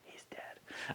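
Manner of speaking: unvoiced deadpan whisper; hushed joke. Real, not acted. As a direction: whisper it as a dark aside. Aside after the knockout.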